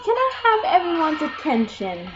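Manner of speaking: lightly acted cartoon announcement; Patrick. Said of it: "Patrick goes in and says".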